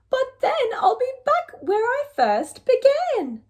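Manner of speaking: high, fretting reply; an old woman doubting the plan; worried old lady. Acted. Lady frets at the wise old man's answer.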